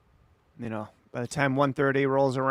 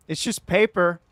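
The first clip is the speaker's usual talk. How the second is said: "It's just paper" loud and higher, falling; loud insistence; 5 semitones higher, 6 dB louder than usual. Real, not acted. Streamer defending the fingernail to chat, loud insistence.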